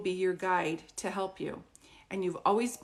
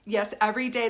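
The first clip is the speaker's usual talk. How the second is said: ordinary delivery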